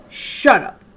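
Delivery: loud snapped shout; yelled. A narrator's reading. Boy yelling at the girl who rejected him. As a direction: yell it back at her. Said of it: "Jason yelled".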